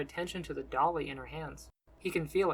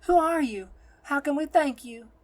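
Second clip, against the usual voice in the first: high, loud grateful amazement; awed questioning; 10 semitones higher, 8 dB louder than usual. A narrator's reading. Nun questions the healer of the children, awed and loud. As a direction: ask it in raised, high-pitched awe. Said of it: "she asks".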